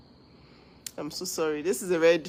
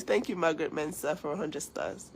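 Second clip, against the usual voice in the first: ordinary delivery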